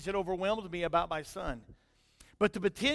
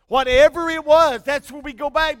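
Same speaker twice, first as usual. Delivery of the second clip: emphatic preaching; 5 semitones higher, 11 dB louder than usual. Real, not acted.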